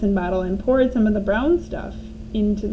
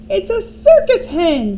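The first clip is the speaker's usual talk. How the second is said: high, loud, slow crone shout; grandma shouting from the rooftop; 9 semitones higher, 7 dB louder, slower than usual. A narrator's reading. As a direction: holler down in delight like an old hag. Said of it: "Grandma shouted from the rooftop".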